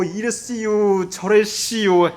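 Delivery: drawn-out Chungcheong dialect, half speed; slow Chungcheong speech. Retold or imitated. Preacher imitating slow-talking Chungcheong locals, drawn-out accent. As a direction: imitate unhurried Chungcheong folk, stretching the endings. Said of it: "충청 분들이".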